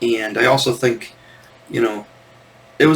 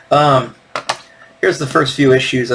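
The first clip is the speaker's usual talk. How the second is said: ordinary delivery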